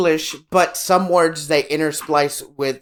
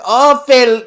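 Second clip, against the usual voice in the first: loud, higher mock Mexican accent; exaggerated Spanish; 5 semitones higher, 5 dB louder than usual. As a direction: start a hammed-up iguana impression. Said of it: "she fucking exaggerates it".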